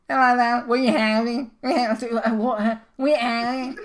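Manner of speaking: high-pitched imitation Scouse; mock Scouse accent. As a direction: mimic a Liverpool canteen girl's strong Scouse. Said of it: "making a mocking of the Scouser accent".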